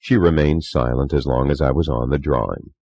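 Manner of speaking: plain reading voice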